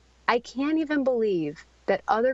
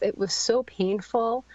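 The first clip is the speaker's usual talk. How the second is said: usual speaking voice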